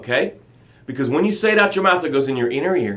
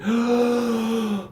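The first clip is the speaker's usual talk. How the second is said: revived gasp; 6 semitones higher, 4 dB quieter than usual. Pretend. Drowning person revived, first breath.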